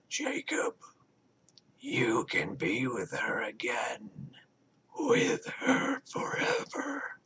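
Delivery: slow, airy whisper, pitch sliding way up; eerie hive whisper. A narrator's reading. Hive mind luring Jacob toward Rebecca, whispering. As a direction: coax him like a creeping voice. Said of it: "Jacob protested".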